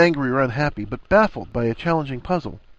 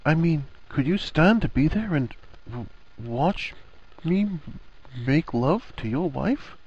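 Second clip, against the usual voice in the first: halting, higher-pitched query; hesitant asking; 5 semitones higher, slower than usual. A narrator's reading. Harry nervously asking Ron an awkward question, halting. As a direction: stumble and pause between words.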